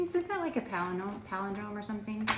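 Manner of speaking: ordinary talk, nothing marked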